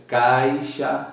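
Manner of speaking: caixa, clear open diphthong; demo word. Shown as a demonstration. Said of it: "veja que é mais aberto".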